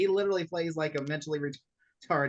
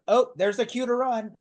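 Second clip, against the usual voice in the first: mock alarm; 6 semitones higher, 5 dB louder than usual. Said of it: "people were like".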